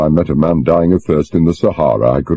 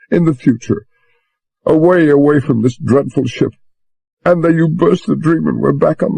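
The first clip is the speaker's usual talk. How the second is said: put-on a young woman's higher, pleading voice; lightly voiced distressed girl; young woman urging him to understand; Susan's voice; 9 semitones higher than usual. A narrator's reading.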